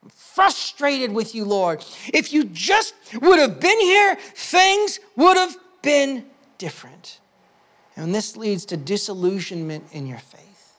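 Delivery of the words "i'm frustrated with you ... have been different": frustrated with God. Retold or imitated. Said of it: "I'm angry at God".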